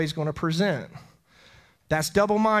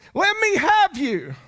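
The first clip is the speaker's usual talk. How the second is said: higher and louder, slow, pleading; impassioned plea; 12 semitones higher, 7 dB louder, slower than usual. Acted. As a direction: cry it out as the Lord calling you. Voicing the Lord's plea.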